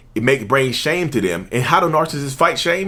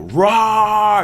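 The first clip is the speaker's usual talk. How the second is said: high, drawn-out mock roar; 7 semitones higher, 4 dB louder than usual. Narcissist raging at being held accountable, mock roar. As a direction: roar in hammed-up rage.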